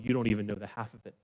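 quick, soft aside under the breath; hurried hush, almost to himself; a fast low-volume aside. Acted. Jesus muttering aside to Pharisees.

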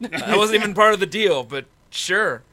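loud, high, thrilled exclamation; can't believe the luck; delighted. Real, not acted. Delighted at free beer.

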